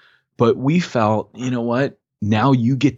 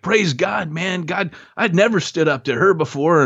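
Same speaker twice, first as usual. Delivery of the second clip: much higher, elated imitation; excited testimony; 9 semitones higher than usual. New believer praising God to his group, excited. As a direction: lift the voice high, imitating his triumph. Acting out man's triumphant testimony.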